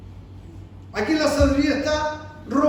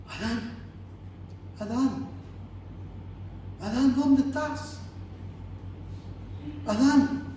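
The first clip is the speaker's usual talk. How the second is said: softer, tender calling out; tearful pleading; 5 dB quieter than usual. Retold or imitated. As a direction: call him gently like a grieving father. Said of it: "con lágrimas en los ojos".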